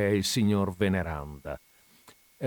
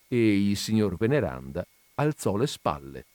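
ordinary delivery, nothing marked

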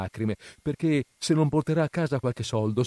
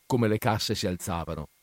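usual speaking voice

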